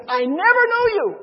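loud, high proclamation as Jesus; preacher's booming quoted rebuke; emphatic rejection. Acted. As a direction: thunder it out, stretching the key word. Preacher voicing Jesus rejecting false believers.

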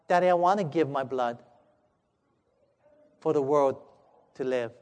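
low, soft voice sinking at the end; quiet resolve. Acted. Little boy offering Daddy his blood, hushed and solemn. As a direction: offer it gently, in hushed phrases.

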